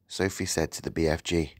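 usual speaking voice

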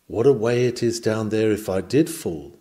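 ordinary talk, nothing marked

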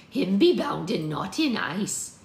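slow, loud rustic crone; drawn-out archaic dialect voice; anxious old woman. A narrator's reading. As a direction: explain it anxiously, stretching the words. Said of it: "explained Maud".

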